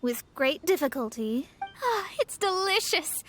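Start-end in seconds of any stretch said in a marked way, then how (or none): 1.8-3.2 delighted. Acted. Master tasting the food.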